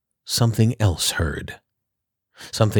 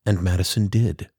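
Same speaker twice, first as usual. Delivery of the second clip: ordinary delivery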